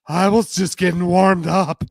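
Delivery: brassy and teasing, fast at the end; Justine voice. Acted.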